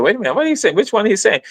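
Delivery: mock confused questioning; puzzled listener. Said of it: "some of y'all might say".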